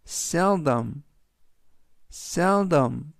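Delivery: seldom, spoken slowly with stress on sel; teacher modelling seldom; slow model pronunciation. Shown as a demonstration. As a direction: sound out seldom carefully, SEL-dom. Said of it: "seldom이라고 발음하시면 되겠고요".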